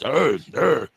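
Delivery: imitated big-animal grunts; two short mock beast noises; beast growl. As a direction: growl like a huge bear. Imitates huge woman as a beast.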